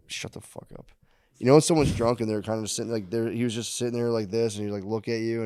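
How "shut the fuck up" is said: breathy unvoiced aside; hushed confiding murmur; whisper. Real, not acted. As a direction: drop to a whisper, let the words trail. Hushed aside in drunk story.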